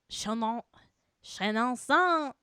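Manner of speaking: ordinary talk, nothing marked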